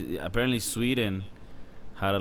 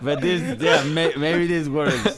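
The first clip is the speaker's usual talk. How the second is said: laughing; 10 semitones higher, 9 dB louder, slower than usual. Real, not acted. Laughing at their own antics.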